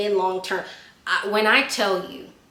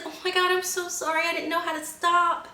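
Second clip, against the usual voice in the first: apologetic scooter rider; 9 semitones higher than usual. Retold or imitated. Scooter rider to the woman she hit, high mock apology. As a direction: imitate a flustered woman pleading sorry. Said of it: "And then she's like".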